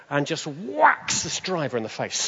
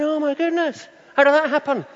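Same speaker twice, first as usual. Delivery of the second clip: much higher and louder, amazed; stunned amazement; 6 dB louder than usual. Retold or imitated. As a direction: gasp it out like you can't believe it. Inner thought after healing.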